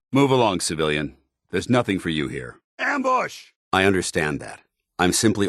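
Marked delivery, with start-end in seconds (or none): 2.8-3.5 sharp high call of alarm; shouted warning. Acted. Soldier warning his squad of an ambush, shouting. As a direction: yell it out to warn the squad.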